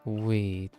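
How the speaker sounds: usual speaking voice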